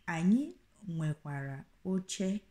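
Anyị nwekwara oche o drawn out slowly, each syllable clear; slow the phrase right down for learners; teacher slowly repeats for the learner; slow teaching repeat. Shown as a demonstration. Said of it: "O is just like an exclamation".

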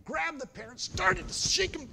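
excited telling. Acted. Man shaking the parrot.

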